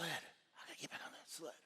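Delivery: barely audible whisper; whispered self-talk. Acted. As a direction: whisper it to yourself to gather nerve. Same self-talk repeated in whisper.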